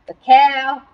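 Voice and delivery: ordinary delivery, nothing marked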